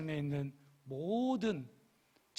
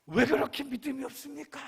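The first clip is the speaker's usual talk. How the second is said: high, raised-voice mock scolding; mock rebuke; 9 semitones higher, 5 dB louder than usual. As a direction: exclaim it as an exaggerated reproach. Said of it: "그럼 제가 그때".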